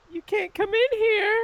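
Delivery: loud, squeaky-high put-on voice; very high-pitched voice. Pickle refusing the visitor at the door, very high-pitched. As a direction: shriek it way up high as a tiny character. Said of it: "Make a very high-pitched voice".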